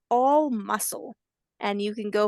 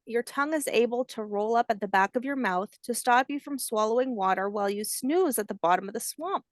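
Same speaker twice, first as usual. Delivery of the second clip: usual speaking voice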